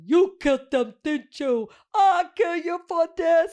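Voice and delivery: high, loud, garbled kid impression; Chunk-and-Sloth voice. Mimicking him in their old murder mystery, garbled toothless shouting. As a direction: shout it like a melodramatic kid missing teeth. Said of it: "cross between Chunk and Sloth from Goonies".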